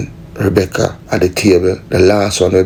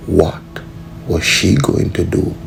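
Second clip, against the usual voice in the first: normal talking voice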